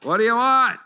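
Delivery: high, loud, offhand church secretary; curt secretary. Acted. Church secretary to general Naaman, loud and dismissive. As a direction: brush him off from the doorway.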